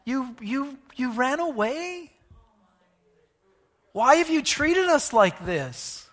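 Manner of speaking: a mother wounded her son ran away; hurt mother. Retold or imitated. Mary asking her lost son why, hurt. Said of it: "She seems hurt".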